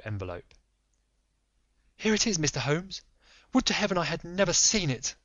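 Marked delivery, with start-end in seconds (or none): 2.0-5.2 higher, anxious noblewoman voice; lady pleading secrecy; Lady Hilda. Acted. Frightened wife to Holmes, higher voice.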